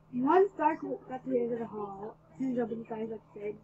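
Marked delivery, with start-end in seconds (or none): none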